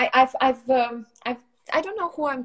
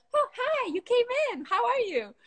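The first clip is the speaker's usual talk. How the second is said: put-on much higher sweet child voice; sudden bright child greeting; high little girl chirp; cheery toddler. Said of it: "she starts talking straight away".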